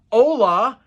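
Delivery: put-on loud, high gringo-accented "Hola"; exaggerated American-accented Spanish. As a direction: overdo the English-speaker accent on "Hola". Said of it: "exaggerate the hola".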